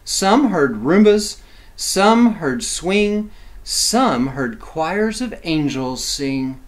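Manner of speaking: slow, rhythmic chant of each line; rhythmic rhyme reading. A narrator's reading. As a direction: savor every rhyme for the kids. Rhythmic rhyming list.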